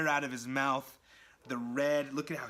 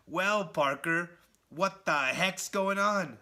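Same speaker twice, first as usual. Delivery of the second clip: slow, higher, louder taunting read; mistaken villain voice; 5 semitones higher, 6 dB louder, slower than usual. Retold or imitated. Vlogger reads Spider-Man's line in the villain voice by mistake, slow high taunt. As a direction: drag it out like the villain would. That's Spider-Man in his head.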